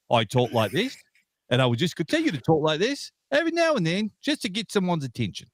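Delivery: a put-on British accent, hammed up for attention; mock British accent. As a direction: lightly play a posh British gent, half joking. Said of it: "I didn't know we had Geico Lizard".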